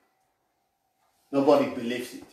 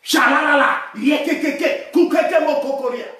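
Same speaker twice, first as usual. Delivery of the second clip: loud, high burst of praying in tongues; voice never going down; 7 semitones higher, 4 dB louder than usual. Shown as a demonstration. Preacher showing that the voice never goes down, loud tongues. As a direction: belt out rapid syllables at full, raised voice. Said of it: "my voice can never go down".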